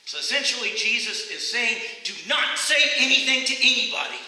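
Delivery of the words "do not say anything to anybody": loud, high-pitched stern warning; stern scolding. Acted. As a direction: scold them firmly, stressing every word. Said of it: "it just means anger or displeasure or scolding".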